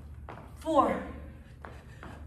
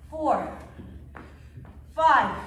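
normal talking voice

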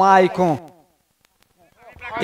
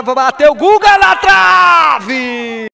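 loud, higher, stretched call; excited shout; 5 semitones higher, 6 dB louder, slower than usual. Real, not acted. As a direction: shout the near miss. Near miss, shouted call.

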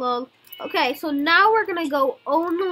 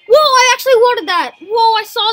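excited; 5 semitones higher, 10 dB louder than usual. Real, not acted. Reacting to rare creature.

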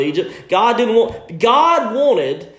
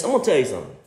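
ordinary talk, nothing marked

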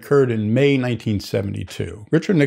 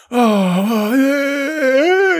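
high, louder whiny protest; mock whining; 13 semitones higher, 5 dB louder, much slower than usual. Student rebuffed by Mike, hammed-up whining. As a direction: imitate a petulant whine, much higher. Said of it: "he's whining".

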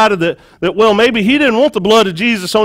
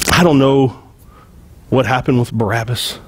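ordinary talk, nothing marked

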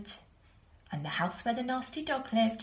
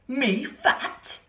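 loud indignant pig; offended pig; 5 semitones higher, 6 dB louder than usual. A narrator's reading. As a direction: huff in outrage. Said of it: "said the pig".

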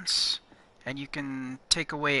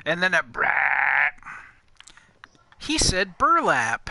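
teasing; 7 dB louder than usual. Real, not acted. Teasing her friend's slurred word.

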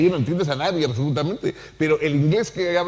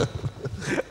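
a short airy chuckle under the breath; soft breathy laugh; 9 dB quieter than usual. Real, not acted. Remembering her father's sayings, a quiet breathy laugh. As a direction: laugh softly, almost silently, at your own remark.

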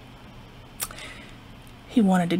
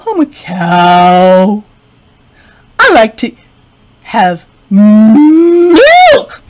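slow big cow voice; 11 dB louder, much slower than usual. Acted. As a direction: drawl it out like a huge cow. Cow answers slowly.

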